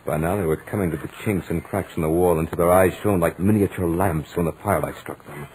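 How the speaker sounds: usual speaking voice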